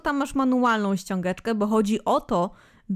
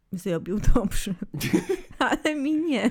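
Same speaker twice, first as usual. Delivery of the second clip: laughing through the words; 3 semitones higher, slower than usual. Real, not acted. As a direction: giggle it out. Laughing at own joke.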